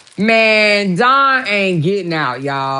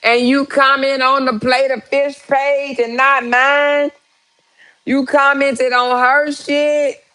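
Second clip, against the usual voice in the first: higher, slow jealous scolding, imitating her; mock mad girlfriend; 6 semitones higher than usual. Jealous woman scolding Robert over his comments, mock angry and higher. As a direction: play the jealous woman chewing him out, hammed-up. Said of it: "She about to get mad".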